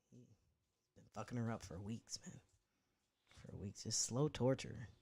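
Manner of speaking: ordinary talk, nothing marked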